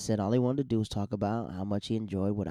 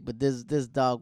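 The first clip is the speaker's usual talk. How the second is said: ordinary delivery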